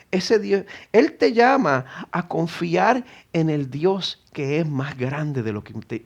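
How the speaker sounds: normal talking voice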